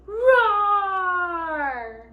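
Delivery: loud, high, long lion roar; scare-off roar. A narrator's reading. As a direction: roar it out to frighten everyone away. Lion roars at the Crocodile.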